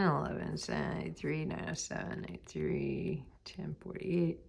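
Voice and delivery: soft, low mutter; muttered to self. Real, not acted. Checking a list of numbers to self, muttered. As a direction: read figures off under your breath.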